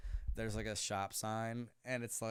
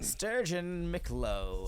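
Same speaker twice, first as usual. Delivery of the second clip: entitled private school drawl; 6 semitones higher, 3 dB louder, much slower than usual. Pretend. Inventing posh rich-kid name.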